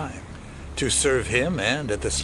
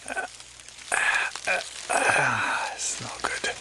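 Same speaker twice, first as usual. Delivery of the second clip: breathy whisper to himself; whispered despair; 4 semitones higher, 5 dB quieter, slower than usual. Acted. Wounded escapee to himself, whispering. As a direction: give up under the breath. Wounded man alone in jungle.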